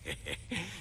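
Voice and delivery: short laugh. Acted. Baron amused by the witcher.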